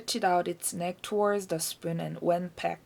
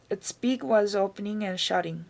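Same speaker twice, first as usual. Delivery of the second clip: ordinary delivery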